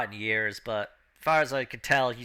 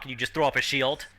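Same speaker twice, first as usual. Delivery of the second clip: ordinary talk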